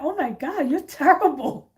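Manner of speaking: friend teasing. Retold or imitated. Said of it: "she's like".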